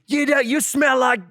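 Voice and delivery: high, slow mock-furious chef insult; hammed-up screaming chef; squeaky over-the-top kitchen rant; Gordon Ramsay yelling. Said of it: "Gordon Ramsay is in your ear yelling".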